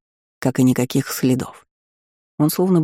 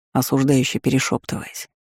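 usual speaking voice

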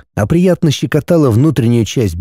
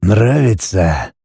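low, slow husky question; 3 semitones lower, much slower than usual. A narrator's reading. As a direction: rasp it a little, tempting. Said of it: "Голос показался каким-то хрипящим".

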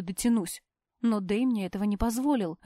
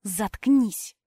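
hissed shut up; 4 dB louder than usual. A narrator's reading. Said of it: "прошипела я".

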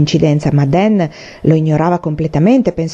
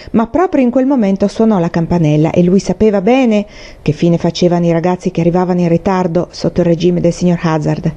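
plain reading voice

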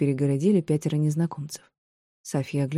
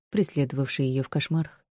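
plain reading voice

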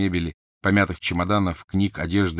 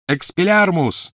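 cast spell; 11 semitones higher, 6 dB louder, slower than usual. Acted. Harry casts disarm.

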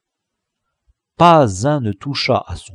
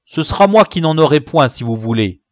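louder and fast, volunteering; cried out; 3 semitones higher, 5 dB louder, faster than usual. Acted. Tigibus calls out to the band, eagerly giving up his share. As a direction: blurt the offer out quickly. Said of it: "s'écria-t-il".

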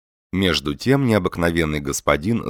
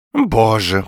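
put-on raised, louder old man's voice; higher, marvelling old man; old man exclaiming; 5 semitones higher, 5 dB louder than usual. A narrator's reading. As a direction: gasp it out in awe as an old man. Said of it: "произнёс старик".

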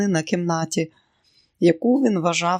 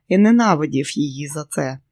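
usual speaking voice

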